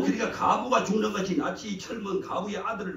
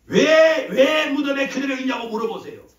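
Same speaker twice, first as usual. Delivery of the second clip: loud, high preacher's cry; preaching shout; 8 semitones higher, 9 dB louder than usual. Real, not acted. Preacher shouting the question at his congregation, loud and high. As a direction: fling the challenge out at the crowd.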